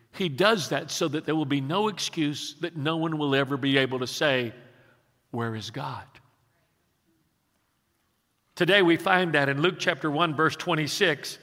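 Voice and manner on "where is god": soft, low imagined question; hushed quote. Retold or imitated. Preacher voicing what nobody could say, hushed and low. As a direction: drop right down, quietly voicing a doubter.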